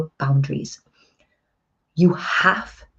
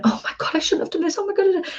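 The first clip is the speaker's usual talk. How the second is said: panicked; 14 semitones higher, much faster than usual. Retold or imitated. Panicked guilty parent quote.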